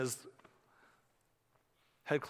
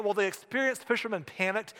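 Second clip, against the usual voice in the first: normal talking voice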